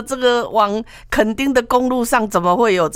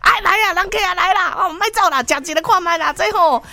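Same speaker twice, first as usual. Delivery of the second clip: high-pitched fruit seller coaxing customers; uncle calling customers; 11 semitones higher than usual. Retold or imitated. Mango uncle beckoning foreigners to taste, high coaxing call. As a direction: urge them over in a high, eager voice. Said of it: "伊是讲".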